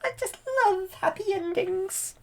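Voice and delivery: put-on very high, weepy old fairy; tearful fairy. Fauna weeps over happy endings, very high. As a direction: sigh it like a sentimental godmother. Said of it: "she's like".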